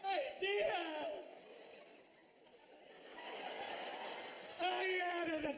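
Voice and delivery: high, strained quarrel over the video; mock distressed argument. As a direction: play both sides of a high-pitched spat. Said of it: "in distress".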